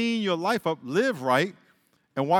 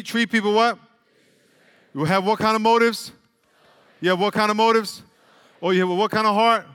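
loud, higher prompting; rally the congregation to answer; loud preacher call-out; call and response; 4 semitones higher, 5 dB louder than usual. Real, not acted.

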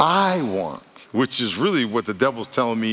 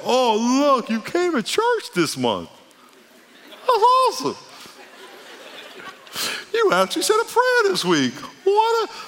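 very high, gushing fake praise; hammed-up high-pitched delight; mock amazement. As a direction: squeal it like over-impressed, sarcastic congratulations. Mock praise God would never give.

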